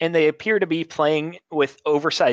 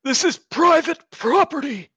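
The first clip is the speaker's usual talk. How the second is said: put-on old man shouting; 4 dB louder than usual. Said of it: "old man ... calls out".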